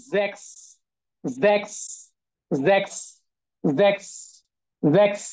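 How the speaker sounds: "জ্যাক্স" starting on voiced z, ending ks; German s as z. Shown as a demonstration. Teacher drills German six for students, "জ্যাক্স" with z. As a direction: drill "জ্যাক্স" singly with a buzzed first sound. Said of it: "এটা উচ্চারণ কি হবে".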